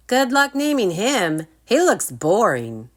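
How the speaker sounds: high, loud mocking jab; piping taunt. Rory mocks Drake's dragon, high and loud. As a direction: tease him loudly. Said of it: "Rory piped up".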